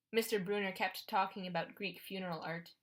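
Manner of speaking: plain reading voice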